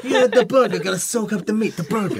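high, teasing mock Italian-gangster accent; mock Italian gangster, tipping into laughter; gangster Italiano accent. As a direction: imitate an Italian mobster, hammed up. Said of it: "this kind of gangster kind of Italiano".

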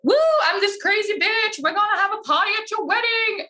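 high-pitched, hammed-up hype; fake party girl. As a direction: whoop it up like a wild party girl. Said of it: "there's no point being like".

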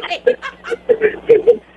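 loud, high burst of laughter; delighted laugh. Real, not acted. Host laughing with the ticket winner, loud delight.

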